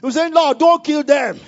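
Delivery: loud, high fervent preaching push; preaching at full force. Acted. Pastor exhorting the congregation, at full preaching force. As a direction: drive the message home to the congregation at full power.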